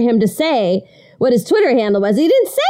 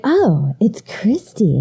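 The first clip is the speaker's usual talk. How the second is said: hushed whisper; breathy whisper of recognition; much slower than usual. Retold or imitated. Imitating listeners recognizing her voice.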